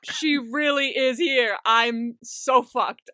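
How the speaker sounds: slow mock realisation of doom; slow dread. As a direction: let each word sink like doom. Junki panics seeing his sister.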